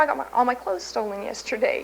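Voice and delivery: ordinary talk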